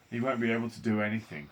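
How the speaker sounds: ordinary delivery, nothing marked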